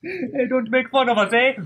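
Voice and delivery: high-pitched put-on Canadian accent; mock Canadian accent. Podcaster joking about ice hockey, mock Canadian accent. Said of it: "making fun of our accent".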